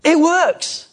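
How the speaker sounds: high, loud burst of delight; excited discovery. Retold or imitated. Disciples returning amazed to Jesus, excited high cry. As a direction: shout it like a thrilled disciple. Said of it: "They came back and went".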